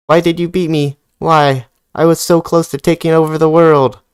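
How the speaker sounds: high, loud villain wail; whiny defeated villain. Acted. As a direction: wail like a sore loser boss. Defeated boss reading its lines.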